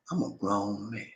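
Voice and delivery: quiet, restrained, weighty declaration; hushed but firm assertion; feeling and power. Shown as a demonstration. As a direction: say it softly, meaning every word. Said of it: "Say it like that with feeling, emotions, and power".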